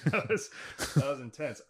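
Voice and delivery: a laugh breaks into the reaction. Real, not acted. Laughing reaction to heavy story.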